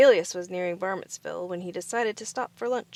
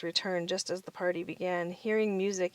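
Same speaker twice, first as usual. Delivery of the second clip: ordinary delivery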